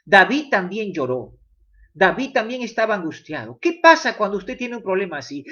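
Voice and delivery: ordinary talk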